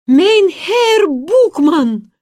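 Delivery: high, loud, drawn-out call. A narrator's reading. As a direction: call out to stop him. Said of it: "крикнуть".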